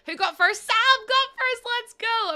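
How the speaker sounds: high, loud hype call; excited cheer. Real, not acted. Streamer cheers the first arrival.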